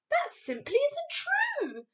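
high, slow, indignant protest; angry cry. A narrator's reading. Sophie defending grown-ups to the giant, angry cry. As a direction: cry out crossly, drawing out the words. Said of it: "Sophie cried angrily".

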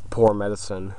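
ordinary talk

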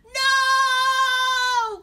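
long, high, full-volume wail; devastated cry. A narrator's reading. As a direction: scream it out in despair. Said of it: "cried Darren, devastated".

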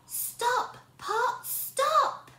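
high, crisp spell; stop spell. Shown as a demonstration. Teacher saying the stop words, high. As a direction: say it high and clear. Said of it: "I need to say".

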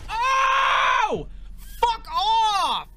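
real rage, yelled very high and loud; screaming fuck off at the screen; furious shout. Real, not acted. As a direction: explode at the game. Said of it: "I am actually pissed now".

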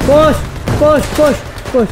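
real urgent push call; urgent shout. Real, not acted. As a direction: drive the squad forward. Urging squad forward.

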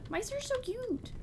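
very high, adoring squeak; cooing. Real, not acted. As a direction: coo over a tiny animal. Cooing over mice.

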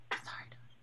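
whispered apology; murmured, nearly voiceless aside; hushed aside. Real, not acted. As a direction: drop to a soft whisper for a quick sorry. Quick apology aside to Dasha.

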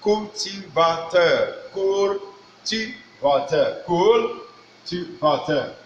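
"Cultivateur" drawn out slowly, falling end; slow careful "Cultivateur"; French pronunciation. Shown as a demonstration. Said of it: "this one says".

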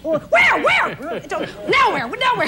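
high frantic questions, voice cracking up; lost in Rome, mock panic; panicked. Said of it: "And I go".

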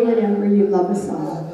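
ordinary talk, nothing marked

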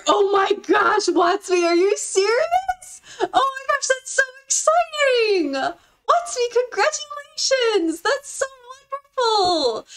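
high-pitched excited squealing; thrilled congratulations. Real, not acted. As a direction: squeal with joy at a friend's big news. Viewer got accepted to university.

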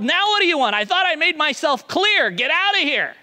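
yelling. Acted. Pub lady yells at returning beggar, loud and fed up. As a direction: shout him off the doorstep. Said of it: "And she yelled".

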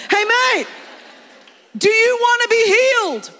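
high, loud comic holler; hammed-up blunt challenge, yelled; brash Jesus. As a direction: shout the question bluntly, without sympathy. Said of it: "he says to him".

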